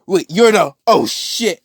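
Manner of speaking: high-pitched startled outburst; acted-out shock, pitched well up; startled realization. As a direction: blurt it out in alarm as you recognize someone. Retold character startled by recognition.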